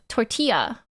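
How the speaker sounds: "Tortilla" with the double L said as Y; corrected pronunciation. Shown as a demonstration. Streamer practising double L as Y, corrected pronunciation of "Tortilla". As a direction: say "Tortilla" as tor-tee-ya. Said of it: "Double L equals Y".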